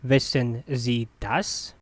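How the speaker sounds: careful, spaced-out teaching model. Shown as a demonstration. Teacher repeats slowly for learners.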